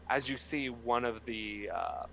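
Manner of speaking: ordinary talk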